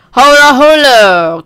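slow, drawn-out loud call. Pretend. Player making noise for the game, loud stretched call.

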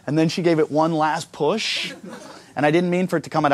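ordinary talk

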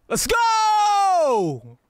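loud excited yell; excited shout. Real, not acted. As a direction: yell it out in triumph. Streamer celebrating sub count.

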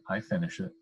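understated, firm resolve. A narrator's reading. Dentist answering his worried wife, firmly. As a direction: state it with quiet finality. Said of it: "said the dentist firmly".

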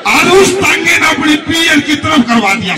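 first, big proclaiming take; emphatic proclaim. Acted. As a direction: declare it to the whole crowd. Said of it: "line said twice".

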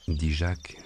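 ordinary delivery, nothing marked

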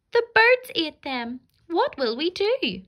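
put-on frightened little girl voice; scared child.

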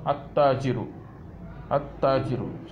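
"at-tajiru" with no l and a held double t; silent lam, doubled t. Shown as a demonstration. Teacher showing learners a sun-letter word, doubled t in "at-tajiru". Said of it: "lam is not pronounced".

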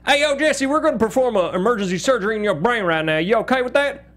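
high, casual Southern drawl; Southern drawl doctor. Shown as a demonstration. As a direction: play a laid-back Southern surgeon. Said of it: "Or if they were like".

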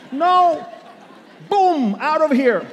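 high, loud burst of joy; excited shout. Acted. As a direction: shout it like a sudden blast. Preacher exclaims rapture.

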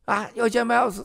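mock French minister. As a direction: lightly play an eager official. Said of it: "然后呢就说".

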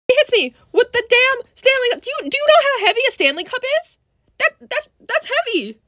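high-pitched outrage; outraged. Real, not acted. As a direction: squeal it in disbelief. Outraged about being hit.

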